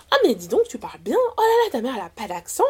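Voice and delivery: retold gushing compliment with high exclamations; mock admiring. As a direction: imitate impressed surprise, leaping up high. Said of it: "Mais genre, de manière positive".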